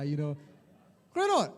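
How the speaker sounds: normal talking voice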